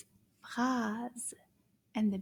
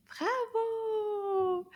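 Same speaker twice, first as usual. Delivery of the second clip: much higher, long, sung-out bravo; high cheering bravo; 10 semitones higher than usual. Acted. Teacher cheering children, high bravo. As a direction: cheer like a children's show host.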